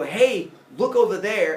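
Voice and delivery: loud, slow distracting call; mock calling out. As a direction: call out to divert someone's attention. Acting out a distraction trick.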